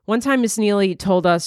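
ordinary talk, nothing marked

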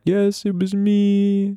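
high, slow, whimpering mock confession; imitating crying. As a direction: sob it out like a guilty little kid. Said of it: "So I start crying and I'm like".

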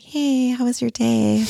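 slow whispered sing-song greeting; hushed, slow, overly sweet greeting; whispered mock cheer. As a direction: tiptoe the question in a whisper. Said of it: "I'm like".

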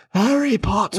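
high-pitched mock-British outburst; put-on British accent. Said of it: "we'll just be like".

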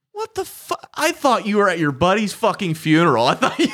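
imitating dad, high and breaking into laughter; laughing retelling. Dad scolding son about the funeral, retold while laughing. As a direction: barely get dad's words out through laughing.

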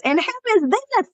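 high, sugary Southern woman voice; thick Southern accent. Retold or imitated. Campground guest asking about the child, thick high Southern accent. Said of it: "helps capture the essence to do her accent".

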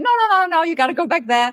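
high, insistent inner voice; nagging ego voice. Retold or imitated. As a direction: nag like a stubborn ego. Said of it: "the ego, might be coming in and saying".

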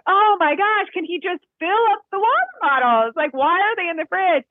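high-pitched, exaggerated exasperation; mock-fed-up inner rant; exasperated complaint. Retells own exasperated inner complaint.

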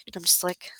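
soft whispered aside. Real, not acted. Wife whispering an aside to her friend. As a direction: drop to a whisper.

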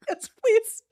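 tearful voice breaking into laughs; real laugh-crying, halting; crying with laughter. Real, not acted. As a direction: try to explain while laughing to tears. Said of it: "I don't know why I'm crying".

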